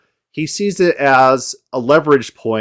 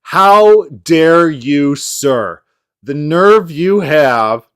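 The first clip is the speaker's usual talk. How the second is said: slow, drawn-out mock indignation, louder; mock outrage; 3 semitones higher, 5 dB louder, much slower than usual. Mocking the debater's fake offended outrage. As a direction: ham up a fake offended huff. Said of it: "And they're like".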